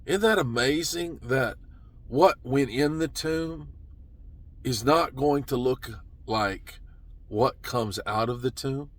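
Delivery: ordinary talk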